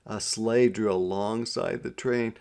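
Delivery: ordinary delivery, nothing marked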